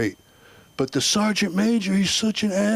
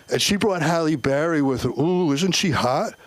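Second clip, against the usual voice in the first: ordinary talk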